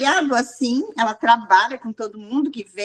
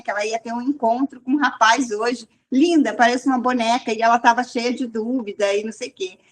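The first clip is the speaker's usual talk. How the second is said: ordinary talk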